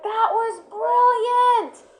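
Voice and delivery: very high, slow, stretched-out praise; lavish praise on the dog; gushing praise. Retold or imitated. Trainer praising dog's good pick, slow high gushing praise. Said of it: "I'm like".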